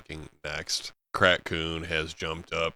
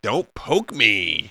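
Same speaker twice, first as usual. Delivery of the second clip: slow mock whine; 4 semitones higher, 5 dB louder, much slower than usual. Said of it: "It's like".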